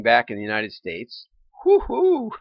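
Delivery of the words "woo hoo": high whooping cheer; excited woo-hoo. Real, not acted. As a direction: let out a delighted whoop. Cheering market recovery.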